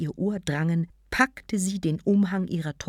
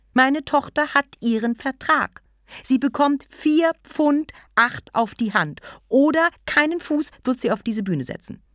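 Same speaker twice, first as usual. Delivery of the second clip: loud, high furious demand; angry; 8 semitones higher, 5 dB louder than usual. Acted. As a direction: raise the voice, fuming. Angry mother demands fee.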